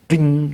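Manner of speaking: high ringing ding; imitated ding. Recapper marks the eyes popping open, high imitated ding. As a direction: sound out a quick, high-pitched ding.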